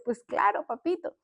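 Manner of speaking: retold sweet yes to a little one; tender grandmother. Grandmother answers grandson who wants a hug, tenderly.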